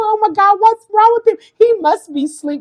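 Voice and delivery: high, anxious fretting; worried. Retold or imitated. Mother fretting over her son's unanswered phone. Worrying about unanswered calls.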